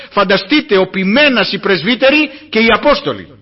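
loud, rising sermon build to a climax; full-force preaching. Acted. (κήρυγμα με όλη τη δύναμη, δυνατά και κλιμακωτά)

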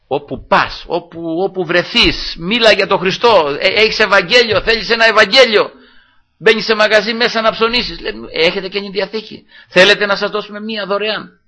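high-pitched, urgent exhortation; fervent preaching. Real, not acted. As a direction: press the congregation to go and witness. (ένθερμο κήρυγμα με υψωμένη φωνή)

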